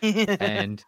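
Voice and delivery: high, loud squeaky little guy. Shown as a demonstration. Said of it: "speaks like this".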